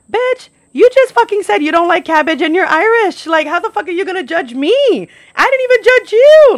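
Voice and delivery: loud, high indignant comeback; outraged rant. Acted. Waitress's unsaid reply to the judging customer, loud outrage. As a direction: blast it out in hammed-up outrage, peaking at the end.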